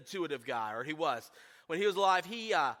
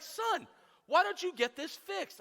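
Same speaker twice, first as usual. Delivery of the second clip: exasperated dad; 9 semitones higher than usual. Retold or imitated. Dad scolding about leaking roof.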